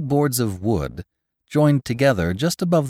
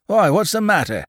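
spluttering; 9 semitones higher, 4 dB louder than usual. Acted. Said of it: "spluttered old Goussot".

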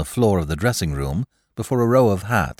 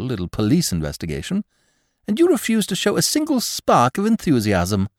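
high, louder mock exasperation; exasperated Lord Peter; 11 semitones higher, 4 dB louder than usual. Lord Peter berating cautious Parker, high and exasperated. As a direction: pile up the insults, high and loud.

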